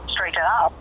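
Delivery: normal talking voice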